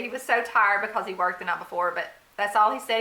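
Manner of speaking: ordinary talk, nothing marked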